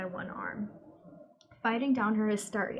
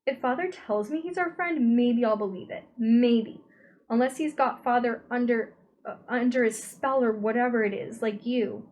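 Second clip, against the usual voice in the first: louder, defiant retort; 3 semitones higher, 6 dB louder than usual. A narrator's reading. Meg defiantly arguing with her possessed brother. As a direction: push back angrily.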